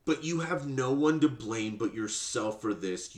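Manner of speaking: ordinary delivery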